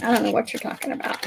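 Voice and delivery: teasing mock denial; mock innocence. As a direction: deny it like you didn't do it. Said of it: "I wasn't yelling".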